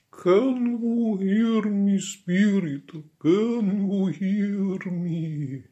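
very high, long-held séance wail; Madame Blavatsky. Acted. Medium calling to a spirit through the ether, high wailing accent. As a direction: intone it slowly in a high Eastern European accent. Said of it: "mobile Eastern European improvised accent".

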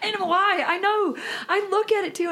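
high laughing disbelief; giggling, incredulous. Real, not acted. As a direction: laugh through each short line.

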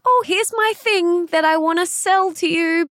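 timid seller. Retold or imitated. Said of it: "hide behind their product and they're like".

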